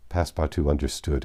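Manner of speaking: ordinary delivery, nothing marked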